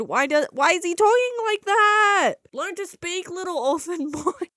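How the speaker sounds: getting carried away with outrage; ranting at the film, half laughing; worked up. Real, not acted. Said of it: "I need to calm down".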